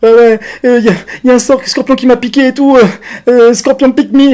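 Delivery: high, loud, frantic broken English; panicked. Retold or imitated. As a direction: blurt it out in a total panic. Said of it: "je suis complètement paniqué".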